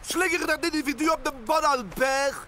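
angry barked insult; furious shout. Acted. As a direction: bellow the insult at the driver. Angry president after crash.

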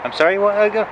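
calling back to the boss through the window, high and puzzled; straining to hear. Real, not acted. Trucker calling back to his shouting boss through the window, high and puzzled.

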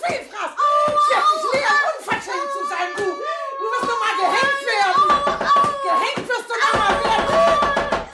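put-on high, loud shrieking at the boy; furious scolding. Matron screaming at the orphan boy, furious. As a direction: scold the brat in a rage.

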